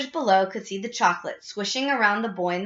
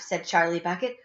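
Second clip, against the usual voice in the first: ordinary delivery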